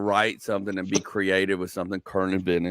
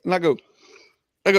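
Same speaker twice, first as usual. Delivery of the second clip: ordinary talk, nothing marked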